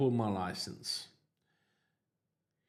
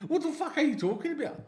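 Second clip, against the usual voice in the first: incredulous; 3 dB louder, faster than usual. Retold or imitated. Baffled reply to the officer.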